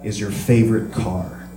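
a hint of a solemn sick father; grave dad. Dad voiced in story.

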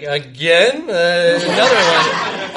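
drawn-out incredulous reaction; exaggerated, stretched-out astonishment; mock disbelief. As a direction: imitate someone who can't believe it happened. Imitating incredulous campus police reaction.

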